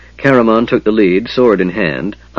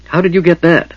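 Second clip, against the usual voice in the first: much higher, astonished question; amazed; 9 semitones higher than usual. Acted. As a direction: ask it in wide-eyed surprise. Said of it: "asked in amazement".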